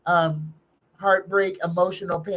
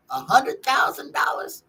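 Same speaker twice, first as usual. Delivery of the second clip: astonished; 6 semitones higher than usual. Real, not acted. Incredulous at the sum asked about.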